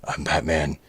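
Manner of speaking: deep, quiet growled catchphrase; Batman growl. Acted. Toy reviewer repeating the figure's catchphrase as a low growl. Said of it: "this figure growls".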